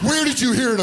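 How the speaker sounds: sudden high shocked question; shocked dad. Retold or imitated. Dad shocked by the kid's words, high alarmed question.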